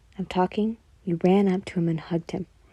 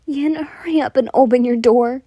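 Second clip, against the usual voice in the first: loud, higher call through the door; yelled; 5 semitones higher, 7 dB louder than usual. A narrator's reading. Mina shouting to her classmate through the door, loud and high. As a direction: Mina yelling at the locked door. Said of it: "Mina yelled".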